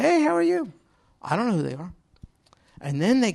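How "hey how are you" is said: higher, bright neighborly hello; high cheery greeting. Retold or imitated. Preacher greeting new neighbor, high and cheery. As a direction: toss it out high and friendly. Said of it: "I say".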